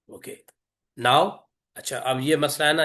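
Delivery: ordinary talk, nothing marked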